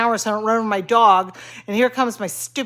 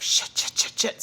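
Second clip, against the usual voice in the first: whispered cursing; 5 dB quieter than usual. Retold or imitated. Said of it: "I'm like".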